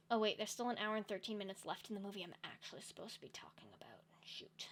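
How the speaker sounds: sudden realization, whispered. Real, not acted. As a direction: whisper it as if caught out.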